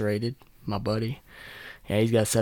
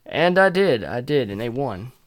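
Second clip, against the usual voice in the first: ordinary talk, nothing marked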